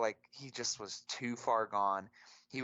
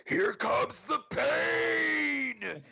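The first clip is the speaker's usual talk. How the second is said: high, loud, drawn-out shout imitating a drunk fan; drunken stadium war cry, mimicked; drunk fan yelling; 7 dB louder, much slower than usual. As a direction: bellow it like a hammered heckler, stretching the last word. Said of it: "He just kept yelling it".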